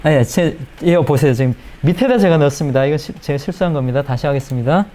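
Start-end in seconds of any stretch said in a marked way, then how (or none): none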